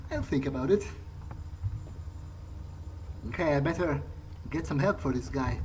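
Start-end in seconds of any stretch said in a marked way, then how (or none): none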